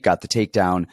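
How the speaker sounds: usual speaking voice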